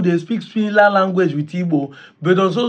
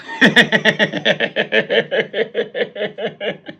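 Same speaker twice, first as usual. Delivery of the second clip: hearty laugh; 3 semitones higher than usual. Real, not acted.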